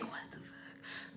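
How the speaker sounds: slow, voiceless whisper; whispered disbelief. Retold or imitated. Thinking to oneself while giving no reaction, whispered disbelief. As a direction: whisper it as a stunned private thought. Retold silent inner reaction.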